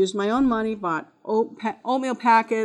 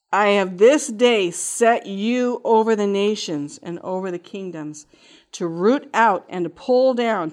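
scripture reading; 5 dB louder than usual. Acted. Teacher telling her story reads scripture solemnly. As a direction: proclaim the verse like a preacher.

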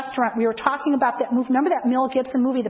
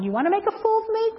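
quick, high and put-upon; exasperated challenge; 9 semitones higher, faster than usual. Retold or imitated. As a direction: fire it off fast, pitch up, half annoyed. Reluctantly giving in to God.

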